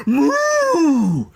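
long high-pitched, loud mock moo; long cow moo. Imitating a cow jumping out.

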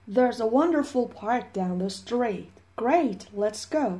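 very slow, clear textbook exchange; slow model conversation; slow dialogue reading. Shown as a demonstration. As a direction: read the dialogue slowly for learners. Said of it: "dialogue model".

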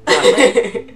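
laughing it off. Real, not acted. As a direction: say it through a laugh. Laughing denial.

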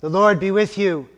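ordinary delivery

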